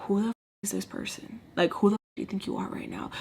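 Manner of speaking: hushed, fast disbelief; baffled whisper. Retold or imitated. Whispering in disbelief at the woman in her face. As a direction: whisper it in shock. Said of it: "y'all, I'm like".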